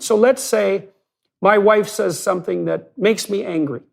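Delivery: ordinary delivery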